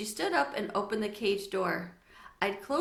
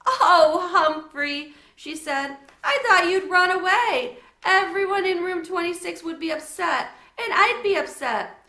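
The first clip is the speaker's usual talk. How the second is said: relieved tearful; 9 semitones higher, 10 dB louder than usual. Acted. Sophie relieved crying.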